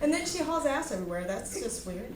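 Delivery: ordinary talk, nothing marked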